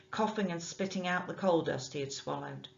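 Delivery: usual speaking voice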